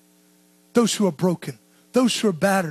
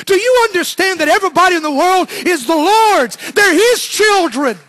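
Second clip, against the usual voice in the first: higher and louder, near crying, slowing at the end; impassioned plea; 7 semitones higher, 5 dB louder than usual. Real, not acted. Preacher urging the church to see everyone as God's, tearful plea. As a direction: plead it with a catch in the throat.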